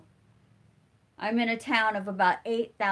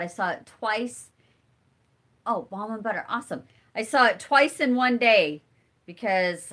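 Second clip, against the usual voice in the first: usual speaking voice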